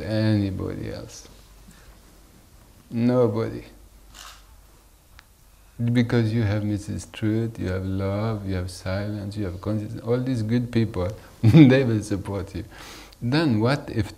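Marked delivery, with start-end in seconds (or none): none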